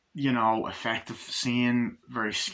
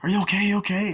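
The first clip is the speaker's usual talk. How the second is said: high, loud worried check; imitating a pitched-up alarmed question; acted urgent concern; 9 semitones higher, 5 dB louder, faster than usual. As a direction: ask anxiously as the rescuer. Rushing to frightened woman, retold.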